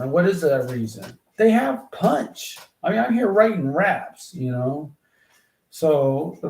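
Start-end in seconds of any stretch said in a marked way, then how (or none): 1.4-2.6 each word hit slow and much higher; punchy emphasis. Shown as a demonstration. Explaining why swear words work, punched out. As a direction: land it like a punch. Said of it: "swear words are popular".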